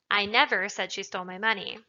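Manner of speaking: "never" punched loud, as an emphatic denial; emphatic "never", falling to the end; stress on NEVER. Shown as a demonstration. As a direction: hit the word "never" hard. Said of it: "an emphatic denial of the fact".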